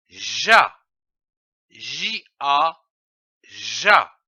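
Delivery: slow teacher syllable drill, letters spelled between; syllable sounded out. Shown as a demonstration. Teacher sounding out ja for a child. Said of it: "Je lis une syllabe".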